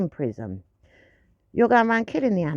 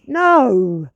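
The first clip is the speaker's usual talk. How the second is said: short, louder firm no; 3 semitones higher, 7 dB louder than usual. Retold or imitated. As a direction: put your foot down with one word. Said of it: "and she goes".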